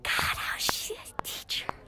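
a secret jab only friends should hear; whispered complaint about the teacher; whispered aside. Acted. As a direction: whisper it under your breath.

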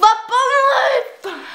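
high, loud, drawn-out outburst; exasperated shout. Real, not acted. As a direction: wail in gamer despair. Despairing at the game.

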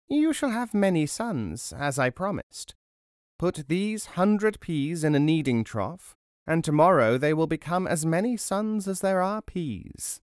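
put-on high old crone making a promise. A narrator's reading. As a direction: lift the voice high like a fairy-tale crone. Crone promises many sons.

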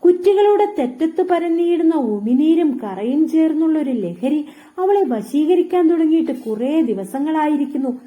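ordinary delivery

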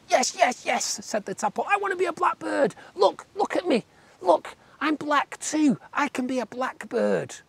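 squeaky tadpole, aghast at frogs. A narrator's reading. Tadpole eager to be blackbird.

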